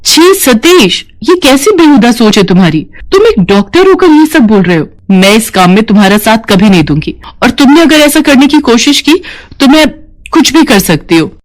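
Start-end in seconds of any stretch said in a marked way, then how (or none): 0.0-4.9 appalled, higher-pitched rebuke; angry. Acted. Nurse refusing the doctor's organ-selling scheme, angry. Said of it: "गुस्सा मत करो". 7.4-11.3 high-pitched angry warning. Acted. Nurse threatening the doctor over his scheme, angry. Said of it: "गुस्सा मत करो".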